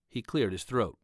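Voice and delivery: ordinary delivery, nothing marked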